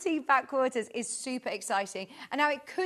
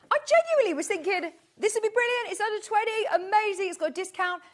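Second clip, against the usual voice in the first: excited; 9 semitones higher, 4 dB louder, faster than usual. Real, not acted. Excited retelling of her thought.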